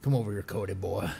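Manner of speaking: a hint of a Southern man calling someone over; playful mock Southern call; Southern drawl. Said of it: "Sounds all Southern".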